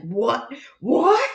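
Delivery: shocked gasp of disbelief, building; amazed disbelief. Retold or imitated. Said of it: "I'm like".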